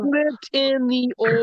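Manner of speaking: slow, lightly drawled "slipped in the oil"; country accent. Retold or imitated. Imitating the ginger country man saying oil. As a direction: drag it out in a mock country drawl. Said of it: "He's trying to say oil".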